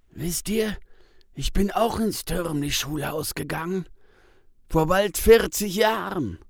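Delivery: Tis tells. A narrator's reading. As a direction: open up with a loud sigh of memory. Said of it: "erzählt Tis". (Tis erzählt laut und gehoben von früher)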